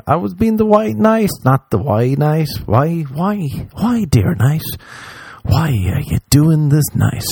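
high, whiny self-mockery; mock self-scolding. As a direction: whine at yourself for playing hero.

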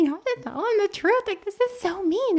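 high-pitched retold child's plea; pleading. Child begs mom to end ransom prank, pleading. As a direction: beg mom, voice pushed way up high.

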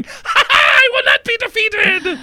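very high, hammed-up villain cry; mock triumphant shout. Comic washing in a rainstorm cloud, mock triumphant shout. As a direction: cackle and declare it defiantly, far too high.

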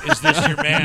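high, loud, gushing ask; a wife thrilled to see her man again; excited mock question. Goldie Hawn approving the de-aged Kurt Russell, high and loud.

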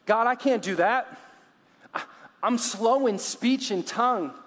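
high, loud mock objection; mock anxious protest. Imagined Moses telling God he can't, mock anxious protest.

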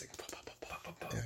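soft unvoiced pow pow pow; whispered pows. Telling a friend about hail, whispered pow sounds. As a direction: whisper the hail hitting your face.

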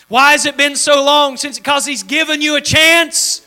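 loud, high, full-force preacher's cry; a revival preacher pressing the crowd; fiery preaching. Acted. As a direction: preach it out loud to the whole room.

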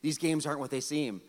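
low, soft confiding warning; explaining father. Retold or imitated. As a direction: quietly let a child in on the con. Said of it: "I try to explain to him".

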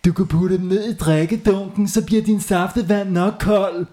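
high mocking kid voice; teasing kid. A narrator's reading. Hans teasing Gurli about the trousers. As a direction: tease with a grin. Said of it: "råbte Hans".

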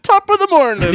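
loud, high stage-Irish greeting; mock Irish accent. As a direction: burst out in a hammed-up Irish accent. Joking about Irish accents.

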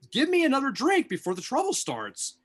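pitched well up, pressing the same order; rising insistence. Acted. Repeated punchline builds up.

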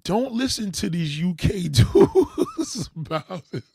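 laughing warning. Real, not acted. Streamer warns her viewers off UK dudes, laughing. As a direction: laugh through the warning, half amused.

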